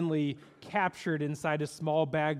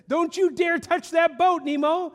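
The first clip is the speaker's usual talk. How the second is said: high, loud stern warning; warning father; 10 semitones higher, 6 dB louder than usual. Retold or imitated. Marlin warning Nemo not to touch the boat, loud stern warning. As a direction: warn him off the boat, hard. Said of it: "Marlin warning Nemo".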